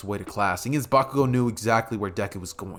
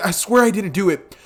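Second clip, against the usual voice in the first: scared plea; 6 semitones higher, 5 dB louder than usual. Acted. Deku with fear in eyes.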